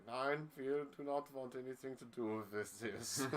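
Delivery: lightly voiced German club official refusing; imitating German teams refusing; mock German. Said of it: "all the German teams were like".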